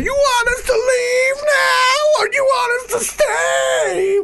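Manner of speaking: very high, slow, wailing shout. Retold or imitated. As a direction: beg the crowd in a shrieking wail. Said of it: "he's, like, going".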